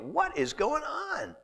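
high, slow exasperated question; upset fiancé. Pretend. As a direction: play the baffled fiancé. Voicing Mary's upset fiancé.

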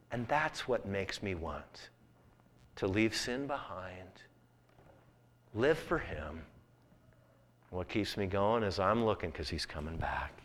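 slow down and let it land. Acted. Preacher turns to quiet testimony.

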